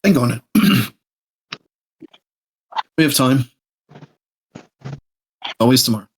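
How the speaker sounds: ordinary talk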